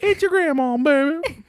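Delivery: put-on high, sassy old granny voice; sassy grandma voice. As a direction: coo it like a cheeky granny. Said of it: "that voice, but for E-Gag".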